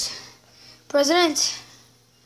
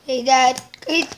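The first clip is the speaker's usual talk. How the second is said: normal talking voice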